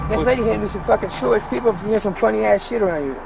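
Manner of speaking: distant, tinny recorded voice; body cam audio. Real, not acted. Body cam clip played in video.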